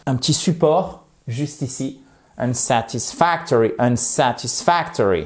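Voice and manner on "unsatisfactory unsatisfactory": "Unsatisfactory" in beats, strongest on FAC; light SAT, heavy FAC in "Unsatisfactory"; rhythmic stress. Shown as a demonstration. Teacher demonstrates the word's rhythm to learners, measured stress. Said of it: "Vous voyez, il y a un rythme".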